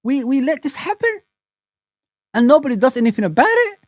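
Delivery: replaying his own stunned reaction; retold shocked disbelief. Retelling the moment he saw the clip, in mock disbelief. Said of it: "I just saw it and I said".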